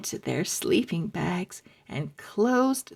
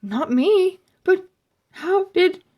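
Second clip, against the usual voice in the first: frightened; 10 semitones higher, 5 dB louder than usual. Acted. Frightened confused boy.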